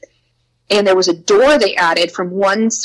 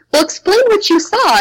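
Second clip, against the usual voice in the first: much higher, eager disbelief; stunned request; 12 semitones higher, 3 dB louder than usual. Retold or imitated. Teachers asking the dad about the cowboy, much higher and stunned. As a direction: ask in wide-eyed shock.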